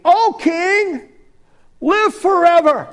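high, loud proclamation to the king; royal address. Acted. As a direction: hail the king grandly. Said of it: "Daniel said to the king".

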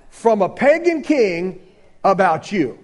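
ordinary delivery

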